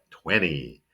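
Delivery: "twenty" said slowly as "twenny"; silent second T. Shown as a demonstration. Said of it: "we pronounce it like".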